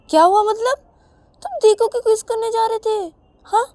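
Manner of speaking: high loud demanding question; accusing questioning. Acted. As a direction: press him hard. Ravi presses again.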